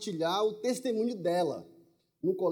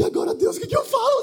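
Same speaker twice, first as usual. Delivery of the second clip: much higher, louder, sing-song panic; mock panic; 7 dB louder, faster than usual. Asking God what to say to her, comic higher panic. Panicked prayer mid-conversation.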